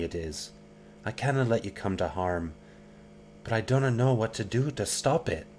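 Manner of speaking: fearful Scottish tenderness; Anna, Scottish lilt. Acted. Voices Anna worried.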